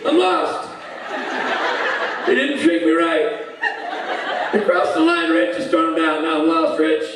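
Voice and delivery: high, plaintive monotone; drunk Rob. Retold or imitated. Lost drunk friend phones Rich for help, high and plaintive. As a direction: whine it like a helpless, overserved friend. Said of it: "phone call from Rob".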